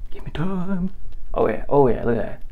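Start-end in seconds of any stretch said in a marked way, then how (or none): 0.1-0.9 whispered, higher, teasing plea; playful whispered give me time. Acted. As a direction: breathe it out like a secret to viewers. Same plea repeated as whisper.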